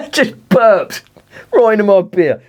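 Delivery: high, loud words through laughter; laughing disbelief. Real, not acted. Telling the dog it just burped, high and laughing. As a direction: laugh it out, outraged.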